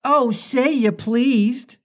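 put-on high, slow pleading boy voice; Nibs crying out. A lost boy begging Wendy to be pleased, high and slow. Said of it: "cried Nibs".

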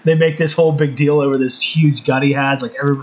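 ordinary talk

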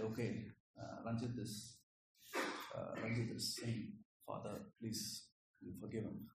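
hushed, pleading voice of Jesus, dropping to a whisper on please; soft pleading Jesus. Retold or imitated. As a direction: quietly plead for Ranjit before the Father. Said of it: "He's like".

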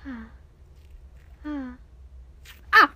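ordinary delivery

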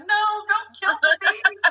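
high, loud mock plea; retold protest. Wife begging husband to spare the bees, high mock plea.

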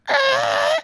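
very high, loud screech; sudden piercing mock skid; tire squeal. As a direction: imitate tires screeching with your voice. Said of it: "I hear tires squeal".

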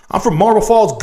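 ordinary delivery

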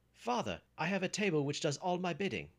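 high, loud excited boast; excited exclamation. A narrator's reading. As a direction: boast to your father, bursting with news. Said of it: "exclaimed Jack".

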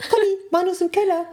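high, fast, laughing groom voice; laughing mock panic. The groom calls Conny about the hiding bride, high fast panic.

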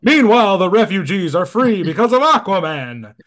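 booming, high cartoon narrator voice; Super Friends narrator. Host reading comic narration aloud, booming cartoon narrator. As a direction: announce it loud like an old cartoon narrator. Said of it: "Super Friends narrator voice".

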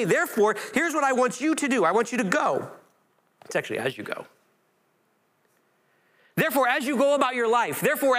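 preacher voicing Jesus sending out disciples; preaching at full force. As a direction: preach it with ringing authority. Reads Jesus' commission, preaching.

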